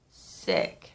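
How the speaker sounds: breathy groan. Real, not acted. Dramatic echo of being sick.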